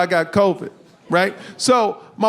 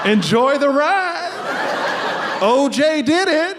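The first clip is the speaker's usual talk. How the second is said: motivational quote; 5 semitones higher, slower than usual. Pretend. Imagined ambulance ceiling quotes, mock inspirational. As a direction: read it like a poster slogan.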